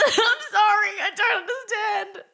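high-pitched, laughing bafflement; laughing apology. Real, not acted. Friend misses the joke, apologizing through laughter. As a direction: giggle through the apology, lost.

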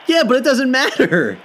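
laughing high. Real, not acted. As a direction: laugh through the line. Laughs while disagreeing.

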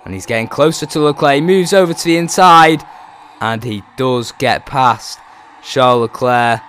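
rising excited race call; excited commentary. Acted. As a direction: call the overtake with building excitement.

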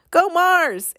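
a fan cheering Mars on, yelled high; crowd yell. Acted. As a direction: shout it from the back of the crowd. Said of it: "someone else yelled".

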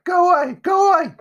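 very high, loud panicked shrieking; desperate boy screaming; terrified cry. A narrator's reading. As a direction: shout frantically at a ghost. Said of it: "he cried".

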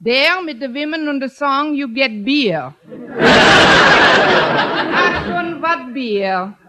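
hammed-up Swiss-German tourist accent; mock continental accent. Traveller urging principal to Switzerland, hammy German accent. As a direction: sell the Alps like a jolly foreign guide.

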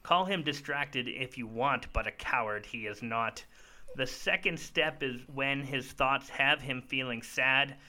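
put-on reading a children's story aloud; storybook rhythm, gently paced; story narration. Reads his children's book aloud.

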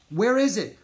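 high, loud, frantic question; crying out. A narrator's reading. Gunner hunting for the package, crying out. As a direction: cry out while searching. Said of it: "cried Gunner".

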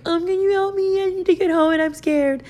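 high, tiny pleading voice; mock yourself as a helpless little girl; hammed-up frightened squeak; scared little girl. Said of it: "this little girl walking up to this big old dude".

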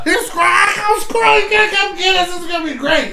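put-on very high, strained drunken squeal; imitated cry-laughing. Imitating drunk friend pranking Squirrel from back seat, cry-laughing. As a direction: squeal it out like a hammered friend in hysterics. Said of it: "like, cry laughing in the back seat".

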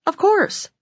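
proud of course. Retold or imitated. Boss answers proudly.

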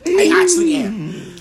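high, loud, drawn-out insistence; real fury. Real, not acted. Storyteller insisting to listeners that the anger is real, furious. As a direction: stretch it out loudly, pitched right up. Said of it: "I'm actually quite furious".

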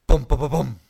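loud punchy drum beats; drum sounds. Shown as a demonstration. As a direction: thump it out like drums. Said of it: "drums that go".

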